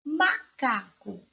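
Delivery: "Macaco" slow, with "ma" set apart from "caco"; syllable by syllable. Shown as a demonstration. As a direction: read "Macaco" slowly in pieces for pupils. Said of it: "Qual é a sílaba tônica dessa palavra?".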